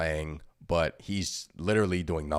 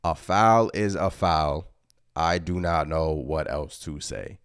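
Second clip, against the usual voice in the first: slow deliberate quote; slower than usual. Retold or imitated. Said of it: "Tom Thibodeau".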